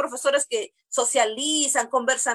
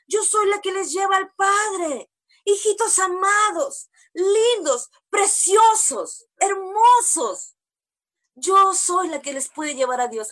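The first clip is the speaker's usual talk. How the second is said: mocking false prophetess; 5 semitones higher than usual. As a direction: ham up a sugary self-proclaimed bride of God. Mocking a false preacher woman.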